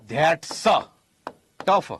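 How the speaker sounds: ધેટ્સ with lingering S, pause, ટફ; that's tough. Shown as a demonstration. Teacher showing how to say it's difficult, split ધેટ્સ / ટફ. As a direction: keep the S on ધેટ્સ, then a separate ટફ. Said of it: "બોલાય કેમ?".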